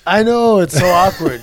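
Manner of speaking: high, loud burst of commiseration; emphatic agreement. Retold or imitated. Wrestler agreeing with colleague about using the accent, exclaiming. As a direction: blurt it out as retold exasperated agreement. Retells his reply to a fellow wrestler.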